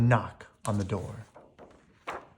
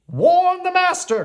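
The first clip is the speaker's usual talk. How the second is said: very high, loud fussy clock alarm; shrill orders to rouse the castle; crying out; 8 dB louder than usual. A narrator's reading. Cogsworth raising the alarm to the servants. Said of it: "cried Cogsworth".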